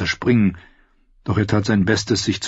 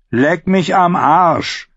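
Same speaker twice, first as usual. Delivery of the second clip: slow, higher, curt retort; gruff refusal; 10 semitones higher, 4 dB louder, slower than usual. A narrator's reading. Big outlaw refuses the singer's order, gruffly. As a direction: throw it back roughly, stretching the words. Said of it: "erwiderte der große Geächtete schroff".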